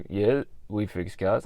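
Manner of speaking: low, slow, flat mechanic; deadpan tradie. Retold or imitated. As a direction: drop the voice and drag it.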